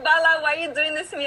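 mad. Retold or imitated. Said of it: "I got so mad at her".

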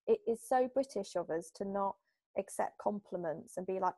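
ordinary talk

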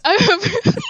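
breaking into high laughter mid-word; laughing fit cutting off the word. Real, not acted. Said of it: "oh my goodness".

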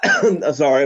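coughing through the words; slow, words broken by a cough; coughing fit. Real, not acted. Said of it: "I'm coughing now".

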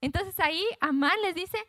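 ordinary delivery

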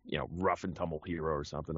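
low morning-voice tough guy; demonstrating a deep action-hero character; rough-and-tumble hero. Shown as a demonstration. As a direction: drop into a rugged hero's low voice. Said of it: "some guy who talks like this".

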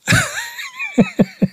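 a high, shrill burst of laughter; high cackling laugh. Real, not acted. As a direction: cackle at your own punchline. Comedian laughs at own joke.

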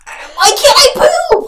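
high, loud comic wail; hollering. Retold or imitated. Retelling her hollering from the toilet so sisters hear, hollering. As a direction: holler it in mock despair through the walls. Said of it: "like, hollering".

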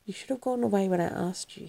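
ordinary delivery

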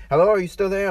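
imitated impatient caller; insistent caller. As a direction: call down the line for a reply. Acted caller checking the line.

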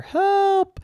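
high panicked call; cry for help. Acted. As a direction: yelp for help. Unicorn calls for help, sinking.

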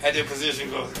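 usual speaking voice

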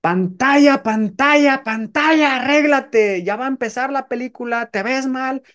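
an outraged moviegoer screaming at the screen; angry shouting woman. Acted. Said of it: "empieza a gritar". (señora enojada gritándole a la pantalla, aguda y fuerte)

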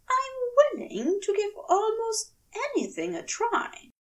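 prim character voice lifted high, exasperated; Aziraphale flustered. Acted. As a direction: plead high and wounded, defending himself. Aziraphale eager offer.

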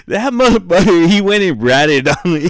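loud, high-pitched indignant outburst; retold outrage. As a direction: protest loudly at being ratted on, half laughing. Retelling outrage at being ratted on.

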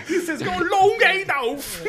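very high, drawn-out bad British accent, laughing; mock British accent. Mocking the clip's bad British, high drawn-out accent. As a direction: mimic a failed British accent through laughter. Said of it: "I was doing a British".

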